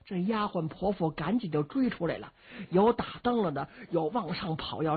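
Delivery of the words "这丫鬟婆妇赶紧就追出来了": ordinary delivery, nothing marked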